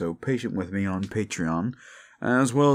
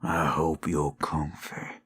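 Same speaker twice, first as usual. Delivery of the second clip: southern werewolf warning the listener away; 2 semitones lower, slower than usual. Acted. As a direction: menace someone who stayed too long.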